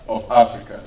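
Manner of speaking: ordinary delivery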